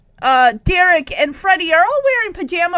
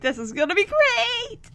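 maniacal grin; 6 semitones higher, 6 dB quieter than usual. Retold or imitated. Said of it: "he's grinning, like, maniacally".

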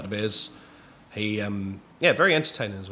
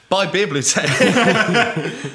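words stretched out through a high laugh; laughing hard while getting the punchline out; 9 semitones higher, 7 dB louder, much slower than usual. Real, not acted. Blaming the guest brewery, laughing high and slow. Joke about being robbed.

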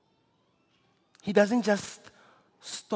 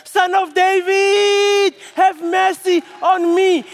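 high, loud shout, drawn out slowly; screaming; 8 semitones higher, 8 dB louder, slower than usual. Acted. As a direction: cry out desperately with everything. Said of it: "you are screaming".

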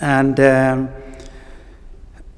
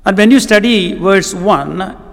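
higher, slower, emphatic preaching on unity; rising preaching fervour; 4 semitones higher, slower than usual. Acted. Preacher urging the Ephesian church to unity, fervent. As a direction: press each phrase like an urgent appeal. Preacher builds urgent appeal.

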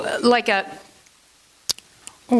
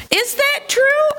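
surprised question; 13 semitones higher than usual. Real, not acted. Wondering about grandma's saying.

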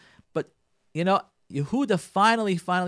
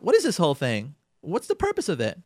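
ordinary delivery

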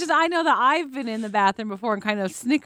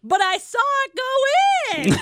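mock scandalized squeal; 5 dB louder than usual. Mimicking girl, scandalized.

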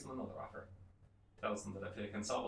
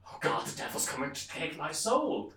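loud, higher mock cry of dread; panicked corpse; 6 semitones higher, 6 dB louder than usual. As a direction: wail in acted alarm as the fake corpse. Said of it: "pretending to be dead looks up and goes".

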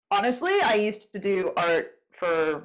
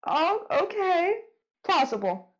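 normal talking voice

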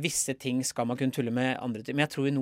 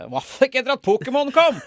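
high, loud burst of glee; excited exclamation; 13 semitones higher, 10 dB louder than usual. Real, not acted. Comedian bursting out about Pokémon, loud and high.

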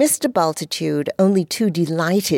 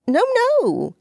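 flustered no. Acted. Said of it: "he said, retreating".

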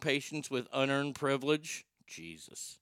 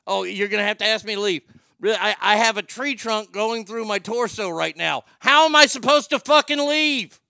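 rising into a much higher, much louder indignant yell; mock outraged rant; 6 semitones higher, 8 dB louder than usual. Hospital patient yelling at staff who would throw him out, indignant shouting. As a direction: blow up at them, louder and higher to the end.

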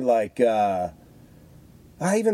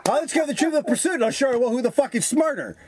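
higher, cocky taunting voice; mock challenge; 10 semitones higher than usual. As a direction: puff up like a bully picking a fight. Challenging five-year-old to trivia.

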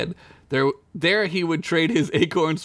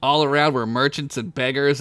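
normal talking voice

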